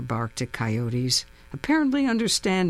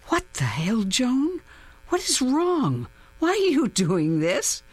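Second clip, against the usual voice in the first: frightened; 9 semitones higher than usual. A narrator's reading. Gun pointed at narrator.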